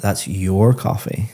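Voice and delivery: "your" leaned on and drawn out, the sentence slow; stress on YOUR. Shown as a demonstration. Teacher demonstrating sentence stress to a student, emphasis on "your". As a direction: punch the word "your" to single out whose it is. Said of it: "you're emphasizing that word".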